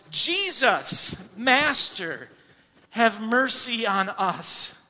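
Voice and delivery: loud, high cry for mercy; loud pleading cry for mercy; loud plea. Retold or imitated. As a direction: cry out to Jesus across a distance. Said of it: "called out in a loud voice".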